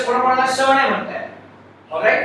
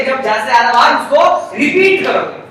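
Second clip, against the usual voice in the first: ordinary delivery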